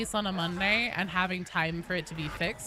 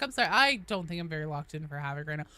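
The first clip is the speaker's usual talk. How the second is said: usual speaking voice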